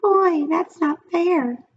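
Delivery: high, slow whine; whiny pigtail. A narrator's reading. The pigtail whines at Paul in a high voice. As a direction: drag it out like a sulky kid. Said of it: "whined the pigtail".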